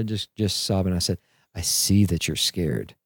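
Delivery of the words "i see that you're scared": lower, slow and soft, comforting a scared son; calm a frightened little boy down gently; a dad soothing his hurt son, soft and slow; soft daddy voice. Retold or imitated. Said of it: "put on my daddy voice and talk soft".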